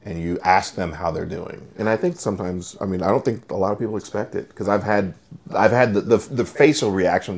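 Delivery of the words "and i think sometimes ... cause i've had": usual speaking voice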